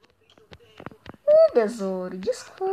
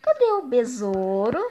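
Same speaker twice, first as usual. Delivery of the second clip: sing-song lure; 3 semitones higher than usual. Acted. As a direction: coax him out like a pet. Luring hiding friend.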